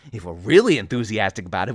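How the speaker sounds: ordinary delivery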